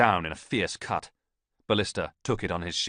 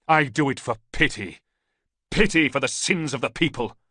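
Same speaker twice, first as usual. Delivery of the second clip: loud, high zealous preaching, slowing at the end; fervent declaration; 7 semitones higher, 7 dB louder than usual. A narrator's reading. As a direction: proclaim his reason with conviction. Priest answers Ballista fervently.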